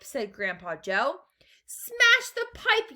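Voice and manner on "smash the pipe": high, loud drawn-out yell. A narrator's reading. Mother wants the blocked pipe broken, yelled. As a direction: yell the order at the top of your voice. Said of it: "yelled Missus Gloop".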